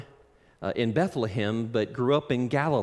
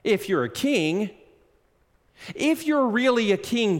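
higher, louder taunting dare; mocking challenge; 8 semitones higher, 3 dB louder than usual. Council taunting Jesus to prove he is king, mocking. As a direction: goad him, rising with scorn. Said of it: "mocking him".

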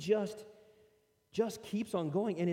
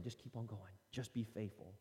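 soft, hushed and lower encouragement; gentle inner voice; 7 semitones lower, 9 dB quieter than usual. Retold or imitated. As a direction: speak it like a quiet inner nudge. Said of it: "The spirit keeps on saying".

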